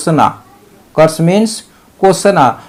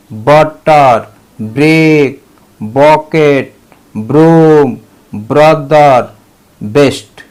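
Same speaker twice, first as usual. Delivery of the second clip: slow drill; much slower than usual. Shown as a demonstration. Teacher reads the word list to children, slow and clear. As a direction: spell the words out slowly and clearly. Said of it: "इस तरह से जो है बी का उच्चारण".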